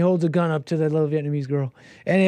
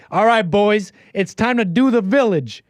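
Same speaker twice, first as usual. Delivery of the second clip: high, louder rallying call to the crew; rallying the boys; 6 semitones higher, 4 dB louder than usual. Retold or imitated. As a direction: rouse the kitchen crew for the rush. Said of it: "I'd tell the boys".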